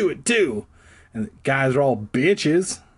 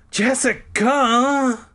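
much higher, louder, drawn-out angry call; angry cry; 13 semitones higher, 4 dB louder, much slower than usual. A narrator's reading. Brother catches his sister with the head, angry cry. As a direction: snap her name, slow and furious. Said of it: "I let out an angry cry".

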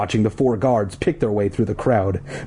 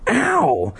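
pained ow; 9 semitones higher than usual. A narrator's reading. Scraped knees.

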